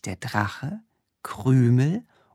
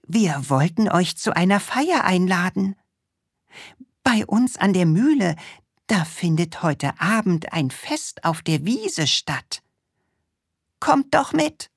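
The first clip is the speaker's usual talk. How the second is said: put-on high, eager little boy voice; Krümel voice; 7 semitones higher than usual. Little Krümel inviting the witch to a party, high and eager. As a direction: play him small and keen, pitched up.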